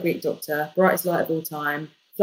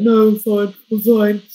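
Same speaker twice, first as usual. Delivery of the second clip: loud, far-off insistent tough guy; husband pretending he's fine, loudly; mock gruff denial; 4 semitones higher, 8 dB louder than usual. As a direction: brush it off like a big man.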